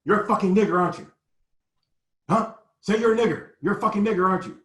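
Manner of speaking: high, loud snarling taunt. Retold or imitated. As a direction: bark it at the kids like a thug with a gun. Retold gunman yelling at boys.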